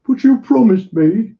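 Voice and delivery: high whimpered complaint; whimpering protest. A narrator's reading. Haberdasher protesting to the musketeers, high whimper. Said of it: "protest in a whimper".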